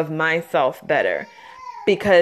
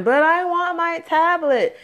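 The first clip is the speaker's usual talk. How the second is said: put-on high, whiny toddler plea, half-hearted tears; mock crying child; 10 semitones higher than usual. Mother retells her son crying over his tablet, high mock whine. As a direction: mimic a three-year-old begging for his tablet. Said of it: "And he's crying".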